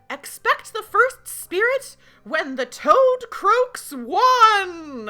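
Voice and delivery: high, loud and slow witch cry; booming witch proclamation. Acted. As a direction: proclaim it like a spooky oracle. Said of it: "the youngest witch says".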